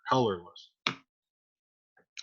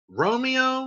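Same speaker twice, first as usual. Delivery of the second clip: mocking; 10 semitones higher than usual. Nurse mocking the name.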